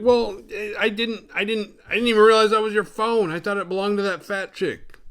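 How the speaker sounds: high, loud, stammering defence; acted-out defensive protest, pitched high; flustered excuse. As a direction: stumble over a panicky mock excuse. Retold flustered excuse when accused.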